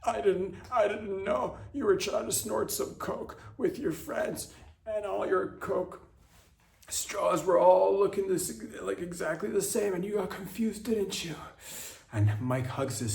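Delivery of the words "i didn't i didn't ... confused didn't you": tearful dad. Acted. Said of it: "Mike starts to tear up".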